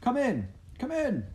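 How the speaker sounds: high, eager welcoming call. A narrator's reading. Pa hails arriving friends, calling out eagerly. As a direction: call them in from the cold. Pa calls visitors inside.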